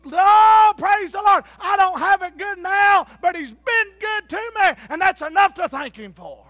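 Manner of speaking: high, loud, slow cry of thanks; shouted praise. Acted. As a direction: shout thanks through hard times. Acting out a sufferer's praise.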